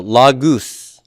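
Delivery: "lagus" said very slowly, both syllables stretched; pronunciation demo. Shown as a demonstration. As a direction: model "lagus" slowly for a student, with a long oo in the second syllable. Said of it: "How do you pronounce that word?".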